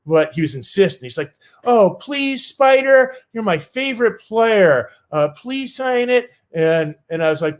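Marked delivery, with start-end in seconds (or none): 1.6-6.3 put-on higher, sweet small-boy ask; little kid pleading. Seven-year-old asking Spider to sign, small pleading voice.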